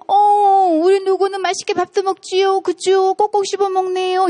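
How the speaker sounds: high, sugary praise for the little one; sweet mother to child. Retold or imitated. Lecturer mimicking a mother praising her child, sugary and high. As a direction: coo over the child's good eating. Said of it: "이렇게 말한다?".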